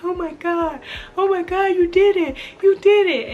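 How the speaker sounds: high, mock-tearful gushing; imitating tearful partner. Imitating partner crying after the birth, mock tearful. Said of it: "He was crying".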